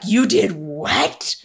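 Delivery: slow, drawn-out mock shock; horrified disbelief. Friend's mom hearing Jesus was eaten, slow horrified disbelief. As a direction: spell each word out like she can't believe it. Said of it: "she treated me like I was Pontius Pilate".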